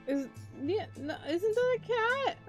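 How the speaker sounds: ordinary talk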